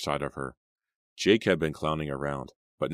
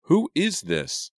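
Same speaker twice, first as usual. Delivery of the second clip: loud, high-pitched angry questioning; angry demand; 10 semitones higher, 6 dB louder than usual. A narrator's reading. Girl pressing the silent caller for answers, angry demand. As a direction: raise the voice, stretching the final question. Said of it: "she demanded angrily".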